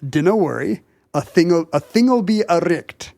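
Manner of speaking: reassuring Scottish father's voice; Scottish burr, drawing out the last phrase; Scottish dialect. Retold or imitated. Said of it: "some weird Scottish dialect".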